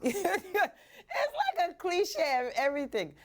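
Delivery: high, slow and laughing; slowed, giggling repeat. Shown as a demonstration. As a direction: laugh your way through it. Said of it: "it's like a cliche of everything in one".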